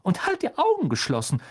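high, sing-song motherly voice; a kind mother ushering the guest first; fussing mother. A narrator's reading. Mrs Weasley voiced by narrator.

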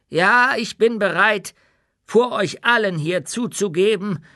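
put-on old dwarf speaking with humility, close to tears; solemn, slightly slowed old dwarf confession; old dwarf, moved. Old dwarf's humble speech.

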